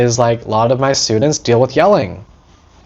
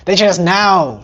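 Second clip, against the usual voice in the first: entitled whine; 5 semitones higher than usual. Retold or imitated. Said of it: "Some of us here are like".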